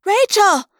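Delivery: put-on high, loud call of a girl; yelled. A narrator's reading. Girl calling to her lost friend, yelled. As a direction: shout the name out urgently. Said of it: "the girl yelled".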